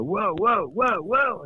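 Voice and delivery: sing-song swooping whoas, rising and falling; mock loss of balance; acted-out wobbling whoas. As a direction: teeter on each whoa as if about to topple. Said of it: "I'm gonna go".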